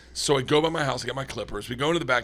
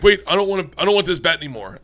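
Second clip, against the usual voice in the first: higher, louder imitation of a panicking kid; mock protest; 4 semitones higher, 6 dB louder, faster than usual. Ten-year-old backing out of the haircut bet, mock protest.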